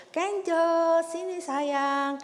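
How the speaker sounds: high, drawn-out sweet call; long, lilting kindergarten teacher voice; teacher calling child. Acted. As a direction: call a little pupil over in singsong. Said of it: "Kalau gurunya panggil".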